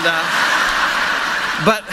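giggling through the words; laughing. Real, not acted. Preacher laughing at his own Walmart story, high and giggly. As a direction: laugh while trying to go on.

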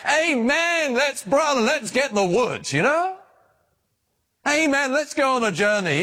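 high, hammed-up jubilant amen; mock enthusiasm. Preacher mocks an overeager believer with hammed-up jubilation. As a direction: ham up a jumping-for-joy believer. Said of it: "not jumping up and down".

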